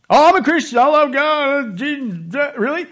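high, loud, syrupy holy act; fake pious voice. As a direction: put on a phony churchy voice. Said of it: "that fake voice".

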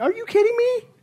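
very high-pitched disbelief; scoffing, pitch way up; incredulous. Real, not acted. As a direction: squeak it out in amazement. Preacher reacts to the excuse.

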